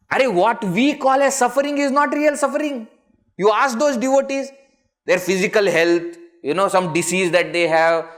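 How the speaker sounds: high, loud preaching that drives the point home; impassioned preaching. Acted. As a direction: press it on the crowd at full force. Preaching at full force.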